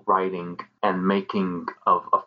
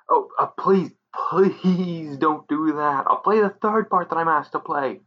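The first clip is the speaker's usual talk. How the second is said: scared stammer; 7 semitones higher than usual. Acted. Extra begging, scared.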